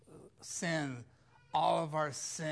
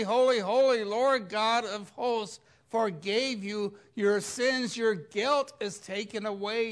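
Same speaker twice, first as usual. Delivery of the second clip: loud, high proclamation at full force; fiery preaching; 6 semitones higher, 7 dB louder than usual. Acted. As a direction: preach it out, building to a shout.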